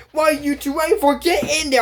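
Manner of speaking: mock-impatient command, pitched high; hammed-up bossy villain; impatient villain order. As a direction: order the henchmen in, high and urgent. Villain ordering her helpers in.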